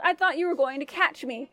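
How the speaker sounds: ordinary delivery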